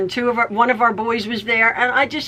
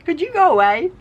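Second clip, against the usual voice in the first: lazy dismissive brush-off; 4 semitones higher than usual. Retold or imitated. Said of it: "I'm reclining".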